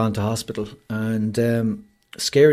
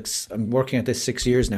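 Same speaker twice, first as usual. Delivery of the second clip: normal talking voice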